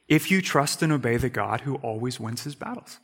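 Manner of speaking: ordinary talk, nothing marked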